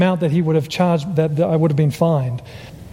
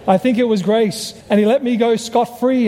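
normal talking voice

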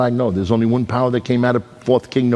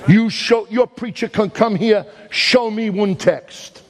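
higher, rising preacher's cry; preaching at full force; 8 semitones higher than usual. Acted. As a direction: drive it home to the congregation.

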